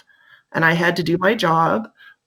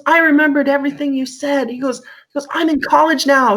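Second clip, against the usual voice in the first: put-on high eager young man; excited youth; 8 semitones higher, 4 dB louder than usual. Young man telling the officer his news, excitedly. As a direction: tell her proudly, overflowing. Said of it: "he was so excited".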